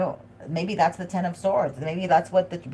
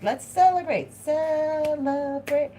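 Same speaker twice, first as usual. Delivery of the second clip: much higher, stretched-out party call; celebrating family; 10 semitones higher, slower than usual. Retold or imitated. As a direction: sing out the celebration, dragging the second one slowly. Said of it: "They're like".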